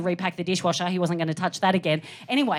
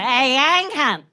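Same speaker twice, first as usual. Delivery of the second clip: loud, higher, run-together Aussie greeting; broad Australian accent; 4 semitones higher, 4 dB louder than usual. Shown as a demonstration. Comic showing how Australians speak, broad loud accent. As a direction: blurt it as one broad Australian sound. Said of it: "That is where I put that".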